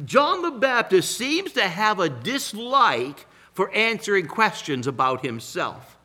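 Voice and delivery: normal talking voice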